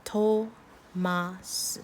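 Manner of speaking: Thomas drawn out as to... ma... seu; Korean-style pronunciation. Shown as a demonstration. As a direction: say Thomas very slowly in three blocks. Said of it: "just a T".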